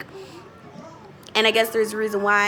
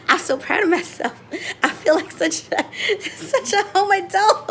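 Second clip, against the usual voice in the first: raised voice bubbling with laughter; laughing with pride; 8 semitones higher than usual. Real, not acted. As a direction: laugh proudly through the words. Giggling with pride at first bake.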